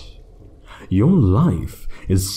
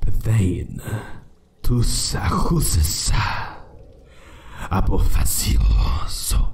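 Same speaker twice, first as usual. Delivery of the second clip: breathy whisper of an ancient spell; whispering arcane words in the old tongue; whispered incantation. Acted. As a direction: hush the old words like a secret chant. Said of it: "Allow me to whisper it in the old tongues".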